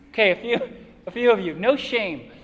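ordinary talk, nothing marked

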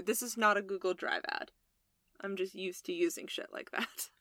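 unvoiced soft aside; whispered aside. Real, not acted. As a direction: drop to a whisper. Aside after joke.

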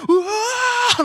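loud, high-pitched imitation scream; crowd's startled yell. Pastor acts out crowd as gorilla charges glass, startled yell. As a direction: imitate a crowd yelling in fright. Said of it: "yelled, like, gasps of air".

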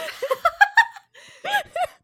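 big squealing laugh; loud high laugh. Real, not acted. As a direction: crack up loudly, high. Co-host joking we saved the city.

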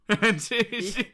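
usual speaking voice

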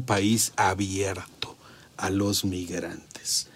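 slow mocking whisper. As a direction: breathe the quote out in a sly whisper. Quoting the secretary, mockingly.

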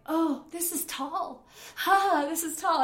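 high, drawn-out dawning alarm; mock dread, pitched well up; rising panic. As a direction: re-enact realising how high up you are. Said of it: "I start to panic".

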